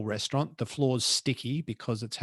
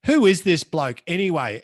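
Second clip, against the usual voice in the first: louder, higher mock drunken heckle; drunk heckler; 5 semitones higher, 8 dB louder than usual. Drunk lady heckling the speaker mid-talk, loud mock yell. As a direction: call it out like a tipsy lady in the crowd. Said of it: "this drunk sixty-something lady just goes".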